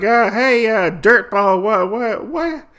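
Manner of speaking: thug voice. Retold or imitated. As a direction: goad the shopkeeper like a bully. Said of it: "and he's like".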